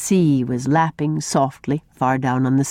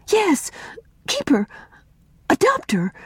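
higher, quick and eager; 13 semitones higher than usual. A narrator's reading. Una urges Miss Cornelia to adopt Mary, eager. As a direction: jump in hopefully. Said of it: "said Una eagerly".

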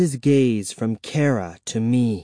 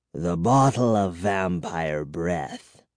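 put-on softened old vampire; 6 semitones lower, 4 dB quieter, slower than usual. A narrator's reading. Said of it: "His expression softened".

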